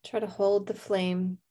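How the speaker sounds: calm meditation voice with long pauses; guided meditation. Real, not acted. Host leads listeners to a candle flame, calm meditation. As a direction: guide them gently, leaving space to breathe.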